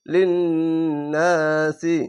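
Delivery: লিন্নাসি, slow, lingering on n and "naa"; wajib ghunna. Shown as a demonstration. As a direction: hold the nn of লিন্নাসি in a nasal hum. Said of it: "ওয়াজিব গুন্না হচ্ছে".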